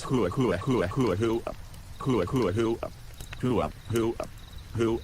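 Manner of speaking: repeated breathy gasps of shock, in a row; mock gasping. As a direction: gasp over and over like you just saw a ghost. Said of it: "we each gasped like this".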